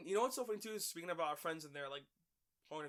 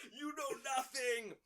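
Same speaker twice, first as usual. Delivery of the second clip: slow, higher mock scorn; mock scornful local; 8 semitones higher, 3 dB louder, slower than usual. Imagined Bostonian scolding the hosts, mock scorn. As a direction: sneer it like a cringing Bostonian. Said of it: "They're like".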